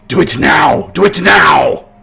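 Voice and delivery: loud, forceful barked command; urgent male shout. Acted. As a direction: shout the order with real urgency. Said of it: "male voice, say".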